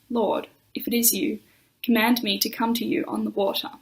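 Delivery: ordinary talk, nothing marked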